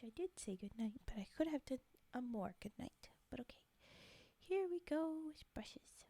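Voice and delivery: soft ASMR whisper; breathy close-mic whisper. Real, not acted.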